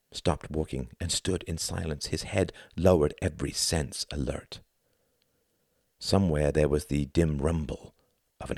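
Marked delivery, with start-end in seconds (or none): none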